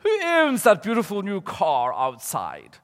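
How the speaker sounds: put-on high, loud, slow Hochul accent; Mister Toad. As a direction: ham up the drawn-out Hochul accent. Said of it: "It's like the Hochul accent".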